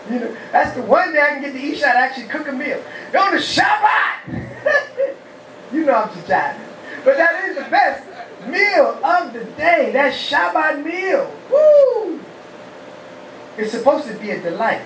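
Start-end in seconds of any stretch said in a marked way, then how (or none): 3.2-4.5 slow, high joking exclaim; mock exclamation. Real, not acted. Preacher joking about wife cooking. 4.5-5.1 short high laugh at own joke. Real, not acted. 11.5-12.2 high, loud whoop of delight; joyful woo. Real, not acted. Praising the Shabbat meal.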